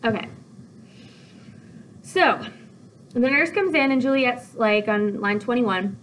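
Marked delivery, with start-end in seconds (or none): none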